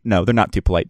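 usual speaking voice

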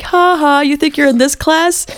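imitating taunting schoolkids, pitched high; mocking. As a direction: jeer in a high schoolkid singsong. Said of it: "they started making fun of her".

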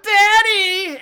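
high, drawn-out dying call; mock cry for help, stretched out. Rich Tits calls out before falling dead, calling out. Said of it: "Rich Tits calls out".

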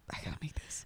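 breathy whispered aside to herself. Retold or imitated. To herself about cooking the salmon, whispered. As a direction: drop to a whisper as if telling yourself.

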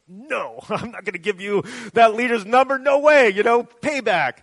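loud, high, gloating no-way with a laugh; mock spiteful refusal. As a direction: ham up a petty payback jeer. Mock vindictive retort, imagined.

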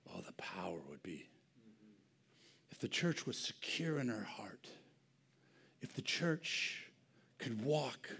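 hushed, awed whisper; whispered longing aside. Real, not acted. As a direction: whisper it with awe. Whispered sermon.

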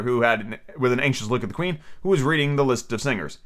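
ordinary delivery

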